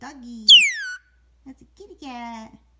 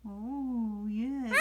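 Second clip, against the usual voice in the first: usual speaking voice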